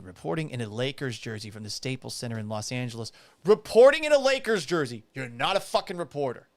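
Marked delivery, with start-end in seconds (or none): 3.4-5.0 high, loud incredulous echo; scornful repeat. Real, not acted. Host repeating her credential to listeners, scornful. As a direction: throw it back in loud disbelief.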